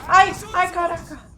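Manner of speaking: loud high panicked cry; panicked yell. Real, not acted. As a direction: yelp it out in alarm. Startled by enemies.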